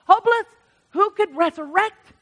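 high, loud cry of Hopeless; exclaimed hopeless. Acted. Preacher describing the valley of bones, a high loud cry.

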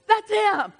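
loud, high, emphatic repeat; emphatic shout. Real, not acted. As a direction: shout it out to the church. Naming the accuser.

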